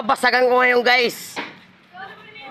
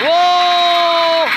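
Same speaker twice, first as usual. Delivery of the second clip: excited shout; 4 semitones higher, 4 dB louder than usual. Real, not acted. Shouting out amid noisy crowd.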